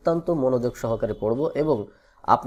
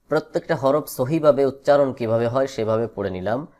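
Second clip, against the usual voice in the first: ordinary talk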